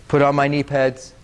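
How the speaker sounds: ordinary talk, nothing marked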